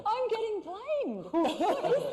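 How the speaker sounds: very high-pitched protest through laughter; laughing mock indignation. Real, not acted. Actress blamed for the giggles, laughing mock indignation.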